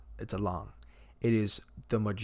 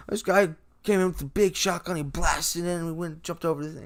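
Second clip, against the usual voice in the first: put-on higher, louder young girl voice; a kid recounting the shotgun break-in, higher and louder; Ellie; 8 semitones higher, 6 dB louder than usual. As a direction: voice Ellie high and loud as she tells it. Ellie quoted in retold scene.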